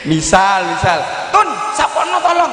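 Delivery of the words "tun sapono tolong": put-on high, sharp bossy command to a child; harsh order. Shown as a demonstration. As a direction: snap the order at Tun even with please. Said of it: "tapi intonasinya". (perintah kasar bernada tinggi kepada anak)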